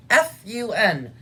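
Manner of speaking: F U N, letters slow and apart; spelled out slowly. A narrator's reading. Stanley spelling for tired Zero, slow letters. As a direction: spell it out for him, F, U, N. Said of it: "Zero sounded out for himself".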